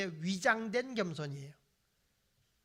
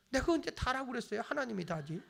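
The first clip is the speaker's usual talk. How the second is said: ordinary delivery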